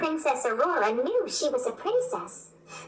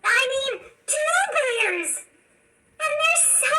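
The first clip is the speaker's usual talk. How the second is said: excited; 9 semitones higher than usual. A narrator's reading. Rapunzel delighted by bears.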